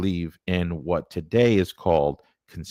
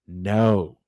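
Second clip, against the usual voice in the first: ordinary delivery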